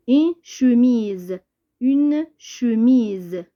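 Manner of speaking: drawn-out, carefully articulated teacher reading; slow dictation. Shown as a demonstration. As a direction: read it out very slowly for pupils. Teacher sounds out word for pupils. (قراءة بطيئة ديال الإملاء)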